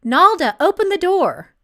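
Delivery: loud call through a locked door. A narrator's reading. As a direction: raise the voice to be heard through the door. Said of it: "She knocked louder".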